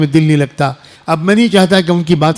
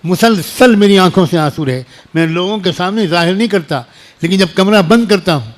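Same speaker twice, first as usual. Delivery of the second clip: ordinary talk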